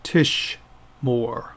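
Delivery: "Tishmor" drawn out with a gap mid-word; pronounced for repetition. Shown as a demonstration. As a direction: say "Tishmor" slowly for students to echo. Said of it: "pronounce them after me".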